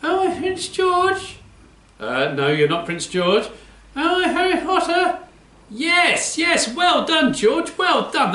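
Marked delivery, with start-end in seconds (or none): 0.0-1.4 put-on high, slow childlike puppet voice; a high little puppet asking slowly. Puppet guessing game. 3.9-5.2 put-on high childlike puppet voice; a high little puppet guessing. 5.7-8.3 high puppet voice, praising. Acted.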